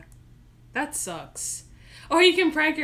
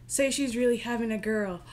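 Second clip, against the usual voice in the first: ordinary delivery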